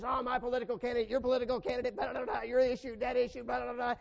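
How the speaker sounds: mock arguer. Mocking a Facebook argument.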